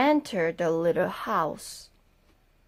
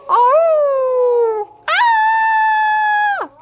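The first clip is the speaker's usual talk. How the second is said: much higher, loud shrieks; 7 dB louder than usual. Acted. Grandma shrieking as the wolf gobbles her up. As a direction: shriek as you're swallowed.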